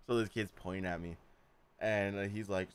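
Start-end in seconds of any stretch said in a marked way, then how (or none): none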